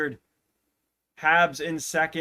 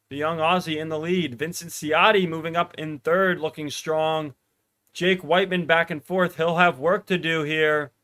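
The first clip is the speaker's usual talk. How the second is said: rising play-by-play, louder toward the finish; live race call; 3 semitones higher, 6 dB louder than usual. Acted. Streamer calling the 1500 meters finish live, building excitement. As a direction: call the final laps as they build.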